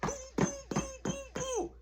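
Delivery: mouthed thuds going downstairs; rhythmic booms imitating heavy steps; puffed-out stomp sound effect; imitated stomping footsteps. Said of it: "you can hear him".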